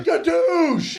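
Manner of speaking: very high, comic yell; mock catchphrase shout. Acted greeting ending in catchphrase shout.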